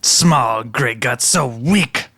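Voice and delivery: slow, sneering little-creature taunt; slow, gloating kobold; mocking kobold. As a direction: throw the insult back slowly. Snack taunts Grey Gut back.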